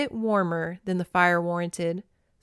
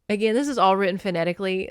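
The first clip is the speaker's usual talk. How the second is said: plain reading voice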